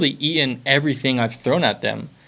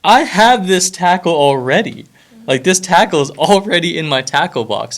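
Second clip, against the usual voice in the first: puzzled disbelief; 6 semitones higher, 5 dB louder than usual. Retold or imitated. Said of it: "sitting here looking like".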